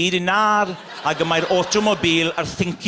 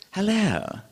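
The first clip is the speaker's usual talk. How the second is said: a hint of a posh English hello; lightly mocked posh English hello; 4 dB quieter than usual. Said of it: "the way the English say hello to each other, it's very posh".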